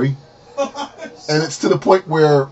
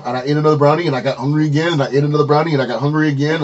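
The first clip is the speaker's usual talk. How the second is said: imitating a fast, run-on excuse; rambling excuse; faster than usual. Woman explaining to friend why she ate more brownies, rambling excuse. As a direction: rattle it off in one repetitive run.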